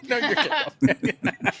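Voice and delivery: high, slow mock repeat; teasing echo. Echoing the friend's Gandalf line, high playful mimicry. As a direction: stretch it out high with a laugh.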